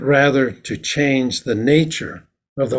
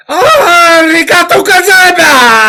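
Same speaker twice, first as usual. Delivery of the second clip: much higher and louder, slow booming thanks; samurai shout; 13 dB louder than usual. Shown as a demonstration. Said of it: "they go".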